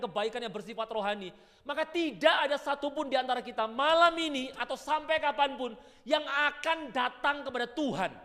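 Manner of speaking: high, loud, ringing sermon voice; full-force preaching. Acted. Preacher drives the point home to the congregation, ringing. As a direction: preach it out to the hall.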